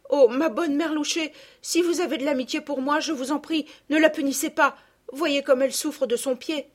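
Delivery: high-voiced, earnest entreaty; sweet young lady interceding, softening at the end; pleading girl. A narrator's reading. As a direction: beg for your friend to be spared. Girl begs mercy for Palmyre.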